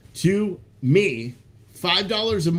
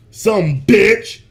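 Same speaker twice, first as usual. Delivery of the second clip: louder, higher, laughing it out; laughing repeat; 6 semitones higher, 9 dB louder than usual. Real, not acted. Repeats the insult to the dog, laughing loud. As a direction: crack up on the repeat.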